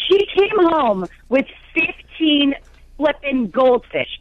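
FIFTEEN FLIPPIN' GOLDFISH slowly, each word punched; exasperated emphasis. Real, not acted. Exasperated rant about school's goldfish.

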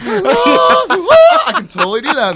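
very high, slow and loud falsetto. Shown as a demonstration. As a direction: squeal it up in falsetto. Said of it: "Your voice went really high there".

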